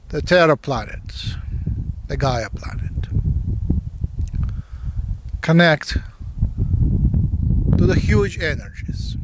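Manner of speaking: meditation guide with long pauses between phrases; guided meditation. Real, not acted. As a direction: give each phrase weight, let it fall, then leave silence.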